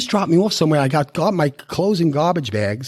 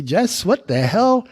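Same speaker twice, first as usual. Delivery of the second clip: higher, drawn-out mock outrage; slow, raised-pitch exasperated complaint, retold; retold scream; 6 semitones higher, much slower than usual. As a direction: mildly re-enact screaming at your sponsor, stretching the words. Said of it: "screaming at my sponsor again".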